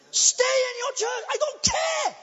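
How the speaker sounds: high, loud defiant cry; loud preacher's challenge; defiant shout. Real, not acted. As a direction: throw it back at them, loud and high.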